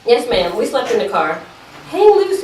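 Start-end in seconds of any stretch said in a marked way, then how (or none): 1.9-2.4 high, loud and breezy; upbeat teen reassurance. Acted. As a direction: toss it off lightly to calm Mama. Brushing off Mama's worry.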